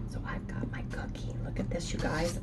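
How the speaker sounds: hushed whisper, showing off a treat. Real, not acted. Vlogger whispers to viewers about her frosted cookie. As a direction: whisper it like a secret snack. Sneaking a cookie before meeting.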